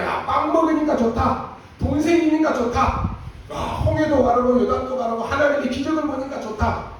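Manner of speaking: giddy Israelites delighting in bread and miracles, hammed-up; mock glee. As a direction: play the crowd gushing like kids. Mocking Israelites' shallow gratitude.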